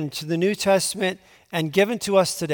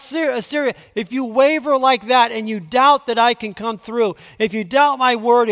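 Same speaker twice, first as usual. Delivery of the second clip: fervent preaching; 6 semitones higher, 4 dB louder than usual. Acted. Preacher warning the king not to waver, urgent preaching. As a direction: drive the warning home from the pulpit.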